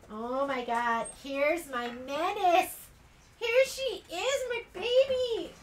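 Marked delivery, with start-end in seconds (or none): 3.4-5.5 much higher, slow, doting pet voice. Real, not acted. Greeting her dog lovingly.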